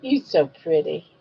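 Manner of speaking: sing-song coaxing, pitch rising toward the end; lightly lilting mama voice; baby talk. Acted. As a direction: coo at the baby to win a smile.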